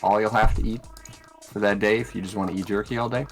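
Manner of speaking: ordinary delivery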